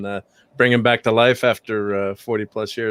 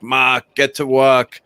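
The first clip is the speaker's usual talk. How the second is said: put-on slow, lightly done Swiss German accent; a hint of a Swiss German dad barking orders; imitating Swiss dad's accent; 2 semitones higher, slower than usual. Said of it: "I'm not doing the accent right".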